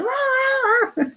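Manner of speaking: high barking dog, rah rah rah; imitating the chatty dog barking; dog bark impression. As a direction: bark like an excited little dog. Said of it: "he goes".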